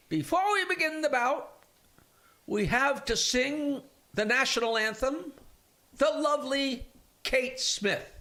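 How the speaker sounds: hammed-up ring announcer introducing the singer; boxing announcer. Comedian retelling a joke, the announcer introducing the anthem singer, high and slow. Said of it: "the announcer comes out and says".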